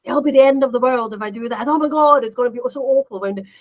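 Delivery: exaggerated mock dread; mock catastrophizing. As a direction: play the drama queen who sees disaster everywhere. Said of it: "we're so great at catastrophizing everything".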